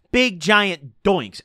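slow, savoring each word; drawn-out emphasis. Real, not acted. Telling viewers about the hotel blunts, slow and drawn out. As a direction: stretch it out like you're bragging. Bragging about smoking in room.

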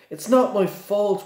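high, whiny schoolboy protest; whining retort. Acted. Son retorting to his father, high and whiny. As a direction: snap back like a sulky boy. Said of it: "retorted Draco".

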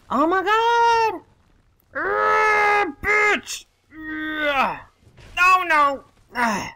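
very high, strained cries in a fight; high-pitched, frantic gamer panic; panicked outburst. Real, not acted. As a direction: yelp and curse as it goes wrong.

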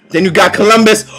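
usual speaking voice

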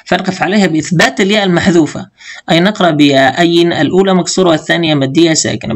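ordinary talk

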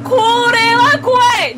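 slow, loud scared cry. Real, not acted. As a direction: wail it in fright. Horror game fright.

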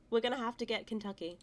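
normal talking voice

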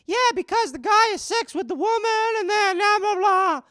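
put-on high, loud childish rattle; a little boy showing off what he knows; young kid voice. Boy bragging to grown-ups, high kid voice. Kid self retold to dad.

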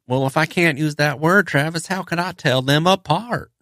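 high, sugary Southern-accented mimicry. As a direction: play the polite Southern inspector in a high sweet voice. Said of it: "She would also say phrases like".

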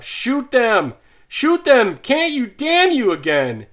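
very high, loud frantic scream; a farmer screaming at the men to shoot the horses; raving yell. A narrator's reading. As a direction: rave and yell it at the top of your voice. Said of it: "Dietering raves and yells out".

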